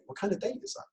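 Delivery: high, soft curious ask; curious question. Shown as a demonstration. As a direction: ask gently for detail. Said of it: "lazy Jedi questions".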